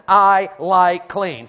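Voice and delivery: slow, loud, stretched out; slow call to repeat. Shown as a demonstration. Preacher leading the congregation in a slow, loud chant. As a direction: drag it out for the crowd to echo. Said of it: "Say it with me".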